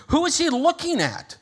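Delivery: high, loud acted question; puzzled crowd voice. Puzzled crowd turns to see.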